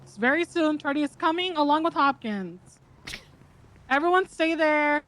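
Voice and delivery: usual speaking voice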